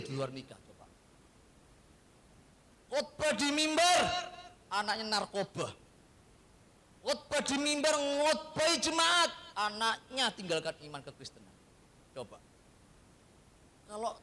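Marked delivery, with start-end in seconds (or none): 2.9-11.5 indignant preaching. Acted. Mocking hypocritical preacher.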